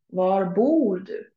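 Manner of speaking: "Var bor du?" slowed right down, each word separate; slow clear model sentence. Shown as a demonstration. Teacher modelling a Swedish phrase slowly for learners. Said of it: "O from, for example, bor".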